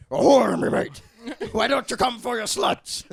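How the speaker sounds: loud hammy pirate voice; pirate accent. Retold or imitated. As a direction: growl it like a pirate. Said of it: "you sound like a pirate".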